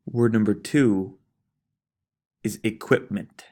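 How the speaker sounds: dictating spelling words; teacher reading a spelling test. Acted.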